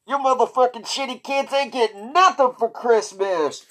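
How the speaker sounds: put-on a nasty Santa cursing at kids; evil Santa. Santa yelling at kids, mock evil Santa. Angry Santa opening bit.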